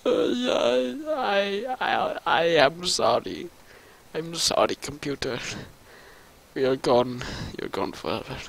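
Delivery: soft, pitiful fake sobbing apology; mock grief. Player apologises to the lost computer, mock-weepy and hushed. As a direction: play a tiny tearful farewell.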